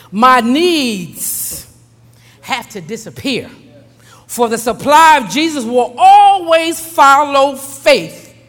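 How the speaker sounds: measured prayer swelling into fervent proclamation; declarative prayer. Real, not acted. Preacher reading a prayer of rest for congregation, solemn then fervent. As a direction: declare each phrase firmly, pausing between.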